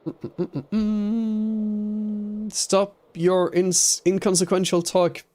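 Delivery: high, slow, grandly scolding; lofty mock-royal scolding; pompous mock voice. Mock pompous reply to chat.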